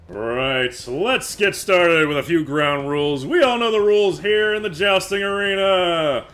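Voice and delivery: loud, high, projected announcing with punched key words; arena announcer. Acted. Announcer giving jousters the ground rules, loud showman's call. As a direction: lay down the rules to the whole arena. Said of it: "the announcer".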